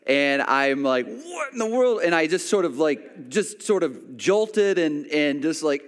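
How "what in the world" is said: high-pitched, drawn-in shock, retold; mock-startled outburst, pitched way up; startled pain reaction. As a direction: blurt it as if suddenly stung. Reacting to a sudden painful sting.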